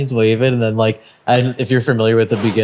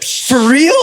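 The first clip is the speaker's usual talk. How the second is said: high, incredulous retold reaction; excited disbelief; 12 semitones higher, 4 dB louder than usual. Friend told to go watch, imitated excitement. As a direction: play the friend who can't believe it.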